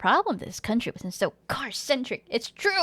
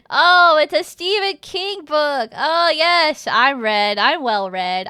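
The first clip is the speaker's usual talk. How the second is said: mock pretension; 3 semitones higher, 8 dB louder, slower than usual. Jokingly claims to know the book.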